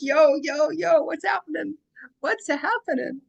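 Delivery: high, playful Brooklyn imitation; jokey Brooklyn "what's happening"; Brooklyn accent. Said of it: "I just love the accents".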